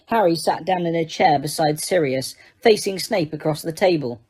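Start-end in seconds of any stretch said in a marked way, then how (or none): none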